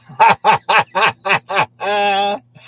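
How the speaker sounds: loud, high burst of repeated laughs; real laughter. Real, not acted. Host laughing at a viewer's message, loud laughter. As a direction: laugh out loud in a long rhythmic run.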